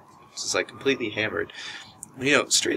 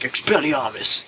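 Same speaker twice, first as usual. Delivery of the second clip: loud, high wizard-duel casting shout; hammed-up Harry Potter spell cast with a wand; a playful fan shouting a spell at a campfire; mock spell; 4 semitones higher, 7 dB louder than usual. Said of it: "doing mock spells".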